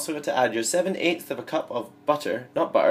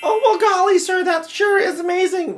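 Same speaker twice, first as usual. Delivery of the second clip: very high, loud hokey voice; folksy character voice; 8 dB louder than usual. Acted. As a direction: gush like a corny salesman. The same character voice again.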